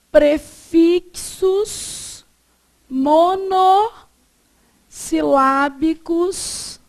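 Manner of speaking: very slow, words stretched and spaced apart; drawn-out syllables for note-taking; slow dictation. Shown as a demonstration. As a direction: dictate word by word, breaking long words up. Dictating a rule while writing.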